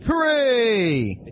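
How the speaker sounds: high, drawn-out hooray; mock cheer. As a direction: cheer it in a long high note. Mock cheer for book announced.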